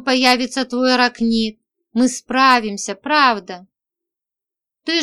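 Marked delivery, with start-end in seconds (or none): none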